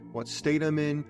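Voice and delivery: normal talking voice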